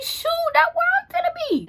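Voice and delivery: loud, very high-pitched squeal of realization; hammed-up high, loud eagerness; eager realization. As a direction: squeal it out, comically urgent. Acts out sudden realization of escape.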